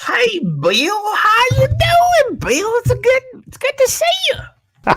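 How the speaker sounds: very high, loud mocking squeal; hammed-up falsetto greeting, stretched out; exaggerated mock accent. Friend throwing a bad accent back at Bill, squeaky mock greeting. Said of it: "It's the only one I can do".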